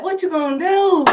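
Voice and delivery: cocky schoolyard dare; taunting challenge. Acted. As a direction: dare him to try anything. Taunting Goliath as David.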